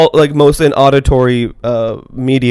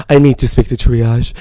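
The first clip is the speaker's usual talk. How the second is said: dramatic sick voice; 4 semitones lower than usual. Retold or imitated. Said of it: "me being dramatic".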